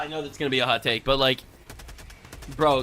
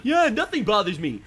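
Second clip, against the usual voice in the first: higher, emphatic mock boasting; mocking imitation; 4 semitones higher than usual. Streamer mocking creator's smoothie shorts, exaggerated bragging imitation. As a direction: mimic his boast with scorn. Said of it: "He's like".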